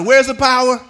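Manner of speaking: sung-out call and response. Shown as a demonstration. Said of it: "that sounded better".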